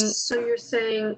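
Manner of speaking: delayed echo of the voice. Real, not acted. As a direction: echo through a speaker. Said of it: "I'm echoing".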